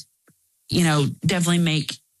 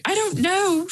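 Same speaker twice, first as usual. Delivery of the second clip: high, wavering mock-teary "I don't know"; slower than usual. Daughter answering her mom, high teary squeak. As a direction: squeak it out like a crying pregnant daughter.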